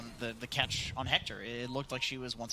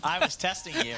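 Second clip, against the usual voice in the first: laughing; 5 semitones higher, 6 dB louder than usual. Real, not acted. Laughing tease after wrong answer.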